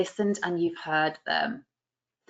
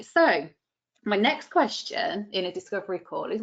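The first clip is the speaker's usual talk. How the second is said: ordinary talk, nothing marked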